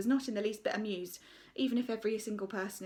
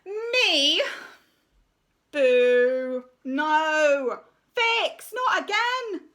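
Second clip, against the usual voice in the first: high, loud whiny complaints; groaning children; 5 semitones higher, 10 dB louder, much slower than usual. Acted. Children protesting the teacher's plan, loud and whiny.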